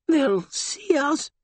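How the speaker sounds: high, slow, fearful voice; scared whimper; frightened. Acted. Frightened joker speaks.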